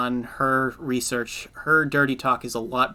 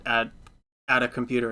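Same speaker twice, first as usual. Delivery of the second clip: ordinary talk, nothing marked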